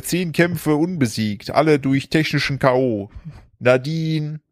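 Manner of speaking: slow, drawn-out ring announcer call; boxing announcer. Pretend. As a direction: stretch the fighter's name for the crowd. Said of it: "Warte, ich muss das sagen. Pass auf".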